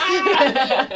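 mock scream. Imagining listeners reacting to the people on the radio, mock scream. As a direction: burst out with a playful scream.

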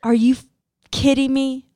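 mock-furious disbelief; retold outrage. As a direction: act out an indignant husband, stretching each word. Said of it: "And Ryan goes".

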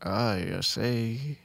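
slow, drawn-out Southern peanut man; southern accent. Acted. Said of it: "like, a southern accent".

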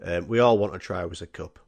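ordinary delivery

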